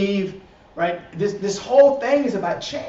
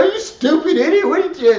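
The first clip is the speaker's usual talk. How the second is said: mock furious outburst, higher and louder; an imitated angry insult, higher and louder; 10 semitones higher, 6 dB louder than usual. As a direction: snap at them like a person losing their temper. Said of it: "can't react and go".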